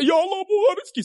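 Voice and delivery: very high pleading wail; mock tearful supplication; imitated wailing prayer. Retold man wailing his prayer.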